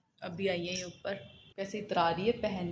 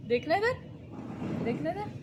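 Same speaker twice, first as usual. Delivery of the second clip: higher playful cooing; 9 semitones higher, 4 dB louder than usual. Real, not acted. Coaxing sulky toddler.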